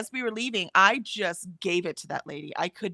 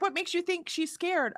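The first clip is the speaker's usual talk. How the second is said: higher mock questioning voice; mimicking the lady's doubting question, higher; imitating the lady; 10 semitones higher, 3 dB quieter than usual. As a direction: skeptical stranger, pitched up. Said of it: "And the lady's like".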